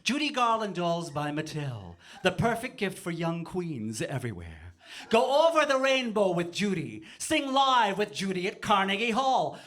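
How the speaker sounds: high, drawn-out commercial pitch; building advert voice-over; mock toy advert.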